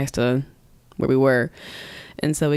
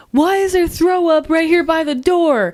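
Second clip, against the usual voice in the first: retold tirade, pitched way up; yelling mom; 10 semitones higher, 4 dB louder than usual. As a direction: imitate a screaming mother, high-pitched. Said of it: "she's, like, yelling at him".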